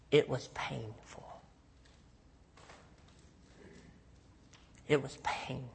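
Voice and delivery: soft, slow, hushed admission; quiet pain. Real, not acted. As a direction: let the pain sink in softly. Painful memory, very quiet.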